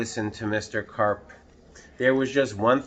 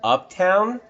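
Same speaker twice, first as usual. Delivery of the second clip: high, louder questioning; boy's anxious guess; 9 semitones higher, 5 dB louder than usual. Acted. Boy asking where to go, high and eager. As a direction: toss out the idea eagerly. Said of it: "I asked".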